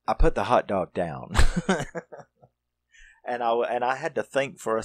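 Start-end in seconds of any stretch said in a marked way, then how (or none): 1.4-2.2 a loud, high burst of laughter; real laugh. Real, not acted. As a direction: laugh out, caught off guard. Laughs at gory movie scene.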